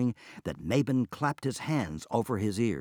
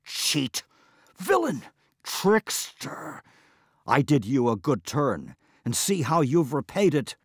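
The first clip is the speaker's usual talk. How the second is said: high, loud angry accusations; furious; 5 semitones higher, 5 dB louder than usual. Acted. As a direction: shake your fist and yell the insults. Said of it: "the furious Mabon".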